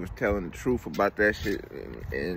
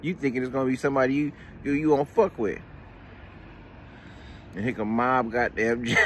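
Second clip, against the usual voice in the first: usual speaking voice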